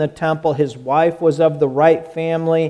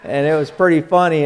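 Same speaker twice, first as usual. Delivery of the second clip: usual speaking voice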